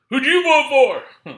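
high, loud, slow whiny question; mock nosy asker. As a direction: ape the nosy person prying about your vote. Mimicking nosy voter question.

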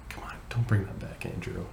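whispered, half-joking scold; hushed whisper. Retold or imitated. Professor whispering to Andrew about his Dropout bag, hushed.

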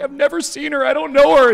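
put-on high, panicky little-boy protest; frightened kid denial. Spooked son denying he knows the girl, frightened. Scared son denies knowing her.

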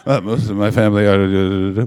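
loud, slow, exaggerated Scottish mimicry; mimicked Scottish accent. Comic mimics an audience member's reply, mock Scottish accent. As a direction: parody her hidden Scottish accent, loud and drawn out. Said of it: "hiding your Scottish accent the way you said".

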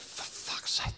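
whispered in amazement; awed whisper. Retold or imitated. Boy stepping into the shed of old bikes, whispered awe. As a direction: breathe it out in hushed awe.

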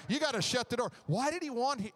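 usual speaking voice

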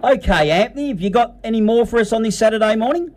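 usual speaking voice

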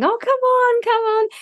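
put-on high, sing-song coaxing of a shy child.

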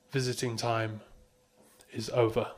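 measured spoken-word poem reading; hushed raw poetry. Acted. Poem's closing line.

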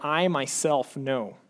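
"I myself know" slow, weight on "I myself"; slow down and press "I myself"; emphatic I. Shown as a demonstration. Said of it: "The pronoun here is emphatic".

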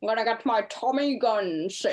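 hammed-up old-time gangster; 1920s gangster. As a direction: play a mock 1920s American mobster. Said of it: "sounds like a nineteen twenties gangster".

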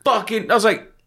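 retelling a jaw-dropping phone call; shocked disbelief. Retold or imitated. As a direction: pitch it way up in mock shock. Shocked at lost property reply.

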